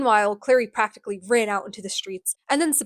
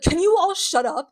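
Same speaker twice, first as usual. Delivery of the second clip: higher, louder irritated outburst; mock exasperated yell; 8 semitones higher, 5 dB louder than usual. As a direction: cut through the argument, acting fed up. Acted quarrel, [name] silences everyone.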